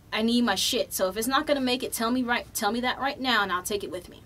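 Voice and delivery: forceful, rushed insistence; insistent demand. Retold or imitated. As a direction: press the agent hard. Insisting to agent.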